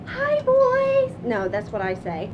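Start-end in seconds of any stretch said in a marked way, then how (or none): 0.0-1.1 high, loud squealed greeting; big high-pitched hello; usual bird greeting. Shown as a demonstration. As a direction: call out high and loud to pets. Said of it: "I need to get my voice right".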